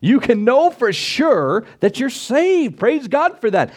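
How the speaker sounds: high, slow, fervent proclaiming; excited preaching. Real, not acted. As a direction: sell the assurance with joy. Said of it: "I'm getting excited".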